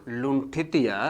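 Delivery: slow, spaced dictation with phrases repeated; dictating notes to a class at writing pace. Acted. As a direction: read it out phrase by phrase for students to copy. Teacher dictating a definition.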